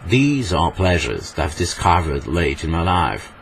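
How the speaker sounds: slow, theatrical English accent; hammed-up English accent, drawn out; overacted English accent. Shown as a demonstration. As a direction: savour each word in an exaggerated English accent. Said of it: "En anglais surjoué, on dirait".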